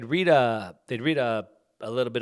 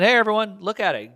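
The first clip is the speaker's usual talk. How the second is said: raised voice, higher and louder; calling out; 9 semitones higher, 8 dB louder than usual. Retold or imitated. Elon Musk releasing the files to everyone, calling out. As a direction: imitate someone hailing a crowd.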